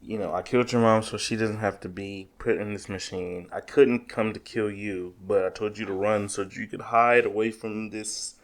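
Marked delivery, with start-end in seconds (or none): none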